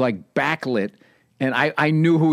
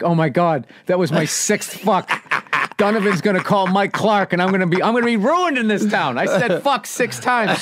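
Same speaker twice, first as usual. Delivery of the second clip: higher, rising panic over the count; hammed-up worry, pitched up; mock panic; 5 semitones higher than usual. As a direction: fret like a young comic who swore too much. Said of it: "being in a panic".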